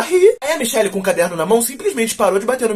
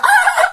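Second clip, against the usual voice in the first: sudden high shriek. Pretend.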